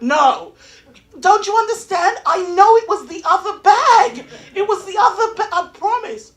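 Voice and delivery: high, loud comic desperation; hammed-up frantic insistence; frantic pleading. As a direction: plead at the top of your voice. Frantic plea to sister about bag.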